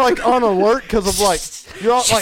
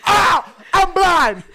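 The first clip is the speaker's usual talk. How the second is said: mock panic; 8 semitones higher, much slower than usual. Webbed in a crawl space, yelling in mock panic. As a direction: ham up being blinded by the web. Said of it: "you're gonna go".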